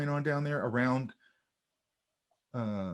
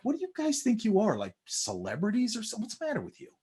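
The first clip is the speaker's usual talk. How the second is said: mock indignant jab; 8 semitones higher, 4 dB quieter than usual. Mocking retold jab at mariachis.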